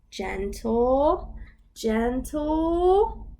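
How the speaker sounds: storybook voice, lively for little ones; reading to children. Acted. Teacher reads the picture book to toddlers. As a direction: read it like circle time.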